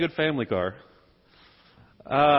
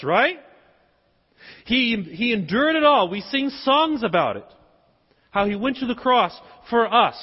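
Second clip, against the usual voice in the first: higher, insistent preacher voice; raised pulpit delivery; emphatic preaching; 6 semitones higher, 4 dB louder than usual. Real, not acted. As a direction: press the point home to the congregation.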